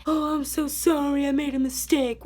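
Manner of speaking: lightly whiny, fake-sorry voice; mock apology. Mocking what coworkers expect, a mild fake whine. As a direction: pretend to grovel, not meaning it. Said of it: "sit here and boo-hoo about it".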